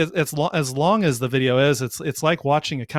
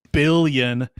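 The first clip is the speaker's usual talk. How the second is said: ordinary delivery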